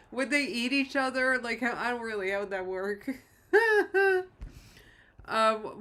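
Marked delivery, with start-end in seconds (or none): 3.5-4.6 high, loud giggle; amused burst of high laughter; laughing. Real, not acted. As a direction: laugh out loud at an absurd thought. Amused by spiders eating each other.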